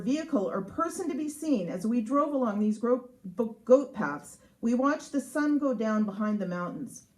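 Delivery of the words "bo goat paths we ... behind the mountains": normal talking voice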